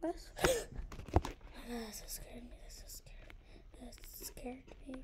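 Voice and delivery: gasp, then breathe the words out shaken; gasp then whisper. Real, not acted. Gamer shaken after a jump scare, whispering.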